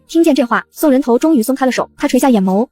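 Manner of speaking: ordinary delivery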